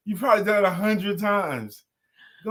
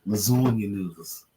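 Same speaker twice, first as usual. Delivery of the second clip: put-on Italian-style accent; 9 semitones lower than usual. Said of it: "A little emphasis on that, a little accent on that".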